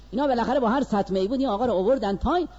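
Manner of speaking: ordinary talk, nothing marked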